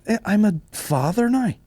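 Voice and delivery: high-pitched self-justifying retort; mock grumpy father. Podcaster retells answering his partner, mock grumpy. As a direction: play up the grumpy dad excuse. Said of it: "anytime I fucking get grumpy".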